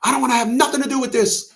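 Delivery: high, fast mock storming off; a hint of a huffy woman; mocking her. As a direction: blurt it out like a sulky child. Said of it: "She blew a gasket".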